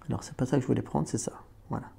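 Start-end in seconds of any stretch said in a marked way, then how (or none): none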